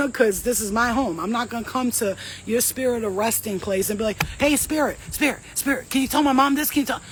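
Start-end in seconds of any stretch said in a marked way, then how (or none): 4.4-7.0 mock pestering voice. Mocking a pestering person.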